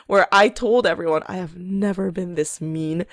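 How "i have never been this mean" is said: quietly admitting she's never been this mean; rueful confession. Retold or imitated. Telling everyone at work, a quiet guilty admission. Quoting herself to coworkers.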